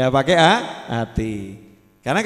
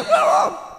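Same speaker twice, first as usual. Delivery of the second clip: very high mock wail; child's scream. Child yelling back while father prays, high mock scream. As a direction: squeal like a noisy child.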